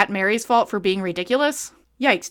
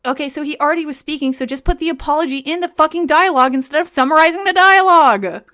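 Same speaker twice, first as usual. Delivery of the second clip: louder, higher frustrated rant; exasperated rant; 6 semitones higher, 7 dB louder than usual. Real, not acted. Reader ranting loudly at the author's dialogue. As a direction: vent at the clumsy writing. Ranting about the book's writing.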